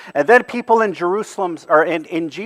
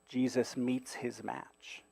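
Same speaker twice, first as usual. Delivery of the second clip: hushed repeat; 4 semitones lower, 20 dB quieter, slower than usual. Shown as a demonstration.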